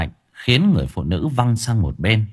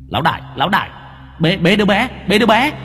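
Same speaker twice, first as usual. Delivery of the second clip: urgent; 10 semitones higher, faster than usual. Acted. Ghost servant urgently telling the boss to grab the baby. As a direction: hurry the boss along.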